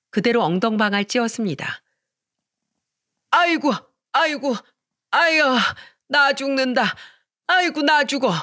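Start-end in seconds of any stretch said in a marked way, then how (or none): 3.3-8.3 put-on high, wailing old woman crying out in pain; wailing mother-in-law. Mother-in-law wails after falling on the floor, high and whining.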